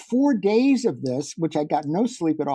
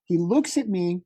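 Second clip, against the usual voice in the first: usual speaking voice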